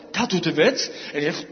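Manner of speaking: ordinary talk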